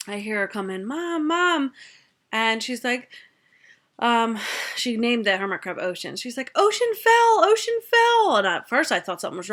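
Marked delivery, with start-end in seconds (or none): 0.9-1.7 put-on high, repeated kid's call; child calling Mom. Child comes in calling mother. 6.6-8.4 put-on very high, loud kid's cry of alarm; alarmed child. Child reports pet's fall, alarmed.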